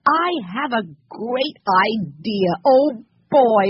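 slow build to a loud, high outburst; excited cry. A narrator's reading. Henry announcing his great idea to the others, excited cry. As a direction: draw it out, then burst out on the last words. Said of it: "cried Henry".

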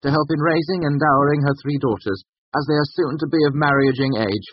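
high, formal will-making voice; loud dictation. A narrator's reading. Bast dictating his will to the scribe, loudly. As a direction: proclaim each clause across the room. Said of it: "Bast said loudly across the room".

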